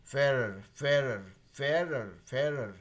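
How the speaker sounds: फेयरर said slowly as fair-er, stress on फेयर; pronunciation demo. Shown as a demonstration. Teacher modelling फेयरर for the class, clear and careful. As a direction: say फेयरर with a clear er ending, repeated. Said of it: "ऐसे pronounce कर सकते हैं".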